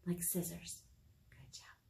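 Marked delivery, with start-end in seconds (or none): 1.3-1.8 soft hushed aside; whisper. Real, not acted. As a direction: whisper encouragement. Aside to kids during song.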